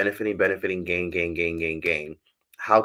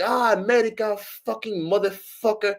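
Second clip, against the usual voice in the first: imitated street man; 13 semitones higher than usual. Man swearing at the American walking off, imitated accent. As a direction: imitate a foreign man cursing in a raised pitch.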